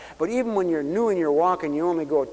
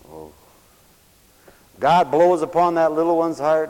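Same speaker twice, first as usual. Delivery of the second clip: ordinary talk, nothing marked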